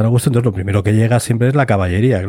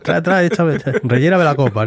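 tipsy soldier; 6 semitones higher than usual. Retold or imitated. Drunk soldier demanding more drink.

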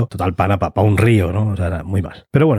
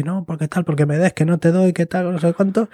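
mock squabble; 7 semitones higher than usual. Soldiers squabbling over the brandy.

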